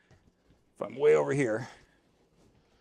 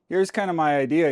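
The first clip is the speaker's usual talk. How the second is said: ordinary delivery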